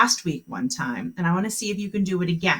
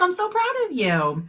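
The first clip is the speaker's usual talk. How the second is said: praise; 11 semitones higher than usual. Real, not acted. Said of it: "praising the students".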